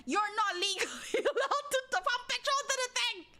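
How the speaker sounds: high, rising laughing protest; mock outrage. Telling off someone too young to drive, mock outrage. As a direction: scold them in a high, incredulous voice. Scolding an imagined underage petrol pumper.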